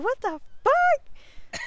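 high-pitched, drawn-out disbelief; retold shock. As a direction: act out shock with the pitch shooting up. Retold shock at spotting the other.

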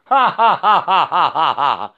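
real cackling laugh, falling away low; mocking laugh. Real, not acted. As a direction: laugh it off, sliding down as it fades. Laughing along with the dog.

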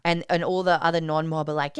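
usual speaking voice

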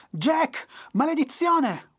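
a devil screaming high and loud, dragged out; furious yell. A narrator's reading. Trapped devil yelling at Jack, furious. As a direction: shout his name in fury, slowly. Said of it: "urlò".

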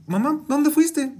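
high, slow puzzled question to her mother. Retold or imitated. Mom asking grandma where she went, bewildered and high. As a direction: ask it drawn out and bewildered.

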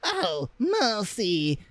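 high, slow horrified mother; drawn-out shocked cry, pitched high. Acted. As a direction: gasp it out in horror. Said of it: "cried the mother".

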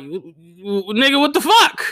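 high, loud outraged disbelief; shocked what the fuck. Retold or imitated. Comic reacting to his friend's news, high loud disbelief. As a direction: squeal out the shock.

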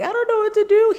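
retelling her panic to her cousin at the airport, high and loud; stressed panic. Retold or imitated. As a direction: cry it out at the end of your rope. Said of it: "I was stressed".

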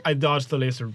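ordinary delivery

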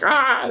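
high-pitched imitated yelp; sudden high mock "ah"; mock cry of pain. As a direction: cry out briefly as if hit, hammed-up. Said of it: "and he's like".

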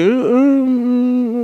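high whimpering hum; pleading puppy whine. Acted. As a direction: beg like a hungry puppy. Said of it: "así de".

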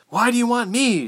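high, loud frightened protest; demanding girl. A narrator's reading. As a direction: demand an answer from your captors, high.

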